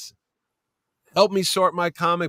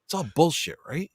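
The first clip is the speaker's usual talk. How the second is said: whispering; 4 semitones lower, 5 dB quieter than usual. Retold or imitated. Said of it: "I whisper in his ear".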